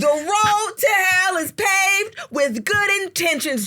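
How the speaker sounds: high, slow sermon-like delivery; preachy proverb. Acted. Teasing Jasmine with a proverb, high slow preachy. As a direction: preach it at her.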